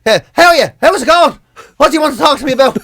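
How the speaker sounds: a pushover welcoming a salesman, eager; overeager easy mark. Retold or imitated. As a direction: greet the salesman like an old friend. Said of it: "people would sell me out".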